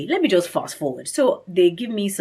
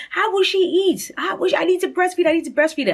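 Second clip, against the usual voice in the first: imitated tearful panic; 13 semitones higher, 4 dB louder than usual. Mother worrying how her baby will eat, high imitated wail. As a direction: re-enact frantic fretting, pitched way up. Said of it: "I just start crying".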